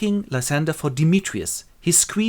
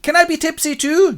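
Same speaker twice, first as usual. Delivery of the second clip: high, loud pleading squeal; over-eager amateur actor; 7 dB louder than usual. Acted. Actor begging the director for another part, eager.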